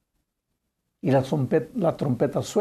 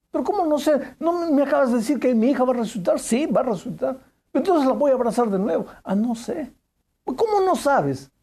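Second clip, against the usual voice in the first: insistent bewilderment; 8 semitones higher than usual. Retold or imitated. Father pressing the girl.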